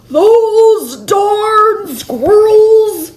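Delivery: put-on high, slow, cranky old man shouting; old man yell. A narrator's reading. Old man yelling at the squirrels, slow high shout. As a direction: holler it like a fist-shaking grump. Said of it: "he shook his old man fist and yelled".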